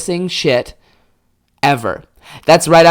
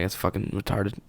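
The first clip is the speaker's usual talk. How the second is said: dismissive dad; 6 semitones lower, 15 dB quieter than usual. Retold or imitated. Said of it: "he was like".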